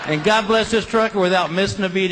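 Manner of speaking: ordinary talk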